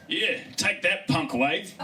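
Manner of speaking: trash-talk the wave like a brawler; cocky fighter yelling at the sea; macho kelp voice. Acted. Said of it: "I could hear him too".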